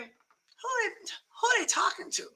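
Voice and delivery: high, soft wondering; mock puzzlement. Listener privately wondering about repeated gossip, mock puzzled. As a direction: think it aloud, high and hushed.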